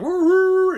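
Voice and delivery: loud, high horn wail; imitated work horn. Joke teller sounding the lunch horn, high loud imitation. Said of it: "there's the fucking horn".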